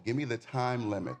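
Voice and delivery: ordinary delivery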